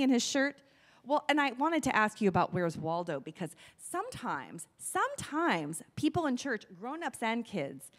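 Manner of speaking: ordinary talk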